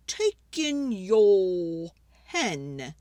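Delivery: put-on slow, measured old man's advice; slow wise old man. Wise old man advising the lady, slow and measured. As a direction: draw it out like a sage.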